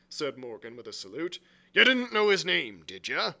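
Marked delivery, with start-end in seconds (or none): 1.7-3.3 loud, leading question; stern. A narrator's reading. Long John questioning Morgan about the name, stern. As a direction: press him for a denial. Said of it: "said Long John very sternly".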